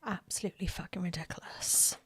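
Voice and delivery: exasperated whispered aside. Real, not acted. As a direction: breathe it out under your breath. Aside about the dog.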